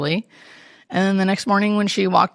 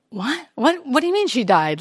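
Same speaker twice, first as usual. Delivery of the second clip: high, stunned What, what do you mean; recalling her own shock at the news; disbelief; 7 semitones higher than usual. Retold or imitated. Hospice nurse recalling the call, stunned disbelief. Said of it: "I remember thinking, like".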